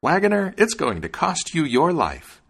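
high, loud bird's warning; threatening sparrow. A narrator's reading. Sparrow threatens the wagoner in story.

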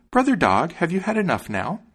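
high-pitched little bird character; high sparrow asking with concern. A narrator's reading. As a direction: ask in a high, lilting bird voice. Sparrow checking on the dog.

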